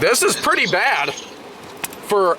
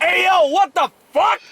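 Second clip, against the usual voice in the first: higher, indignant Mother Nature; outraged Mother Nature; 11 semitones higher than usual. Retold or imitated. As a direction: squeal it out as offended Mother Nature. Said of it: "No, she'd be like".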